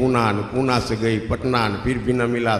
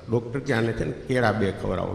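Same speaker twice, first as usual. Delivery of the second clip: usual speaking voice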